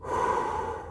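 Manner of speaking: a long audible breath in before the throw; deep inhale. A narrator's reading. Izuku readies himself before throwing: deep steadying breath. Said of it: "takes a deep inhale".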